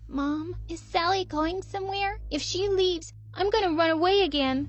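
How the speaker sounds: put-on a small girl threatening to run off; little girl pleading. Child pleading with her mother to keep the nanny, high voiced.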